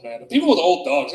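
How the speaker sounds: ordinary talk, nothing marked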